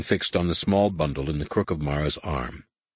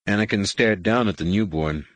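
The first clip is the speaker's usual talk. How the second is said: plain reading voice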